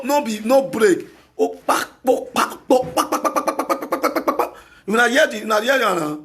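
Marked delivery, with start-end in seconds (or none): 1.4-4.5 rapid-fire "pak" sound effect; mouth-made smacking, bursts of pak-pak; imitated smacking noise. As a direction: pop out a run of percussive paks.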